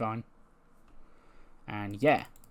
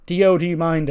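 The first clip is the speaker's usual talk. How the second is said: higher, louder call to a friend; raised-voice mock request; acted calling over; 7 semitones higher, 10 dB louder than usual. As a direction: pipe up to ask a favour. Calls to Dio for water.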